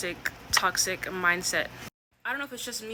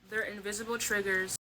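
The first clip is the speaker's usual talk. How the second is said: ordinary delivery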